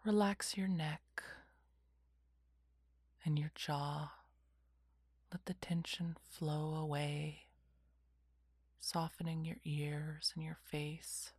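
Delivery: whispered guided meditation; slow calming whisper, lots of pauses; soft spa whisper. Acted.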